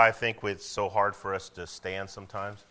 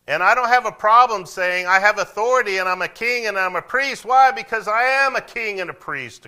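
high, loud preacher's declaration; impassioned preaching; 12 semitones higher, 13 dB louder than usual. Real, not acted. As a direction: preach it at full force. Preaches at full force.

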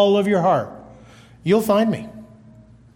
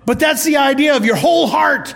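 higher, louder shouted point; preaching shout; 6 semitones higher, 4 dB louder than usual. Real, not acted. Preacher shouts to the congregation about the whole heart. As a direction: hammer it home to the congregation. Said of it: "preaching emphasis".

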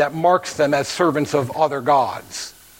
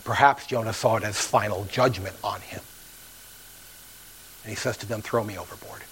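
hushed resignation; 5 semitones lower, 7 dB quieter than usual. Acted. Jonah resigned to judgment.

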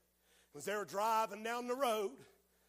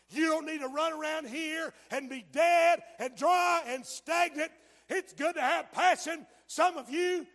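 shouted warning sermon; preaching at full force; 7 semitones higher, 9 dB louder than usual. Acted. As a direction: pound each line at the congregation.

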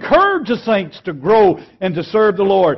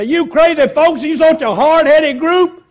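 higher and louder exasperated rant at the flock; mock angry scolding; 9 semitones higher, 5 dB louder than usual. Preacher mimicking an impatient pastor scolding his stubborn congregation, higher and louder. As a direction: scold them like a fed-up preacher. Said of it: "get all mad and upset and say".

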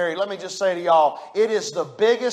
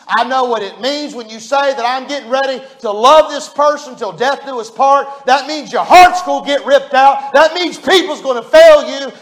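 louder and higher, building toward a near shout; preaching at full force; 5 semitones higher, 8 dB louder than usual. Acted. Pastor warning young couples about marriage, building preaching. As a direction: drive each "That means" home harder than the last.

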